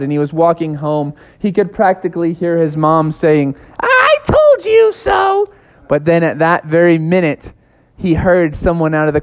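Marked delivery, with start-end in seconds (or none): 3.8-5.5 very high, slow told-you-so; scolding mom. Acted. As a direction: drag it out like a smug mom. Mom voiced in his head.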